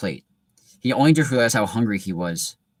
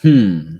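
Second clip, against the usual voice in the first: hat's musing hmm; 2 semitones lower, 9 dB louder than usual. Acted. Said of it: "said a small voice".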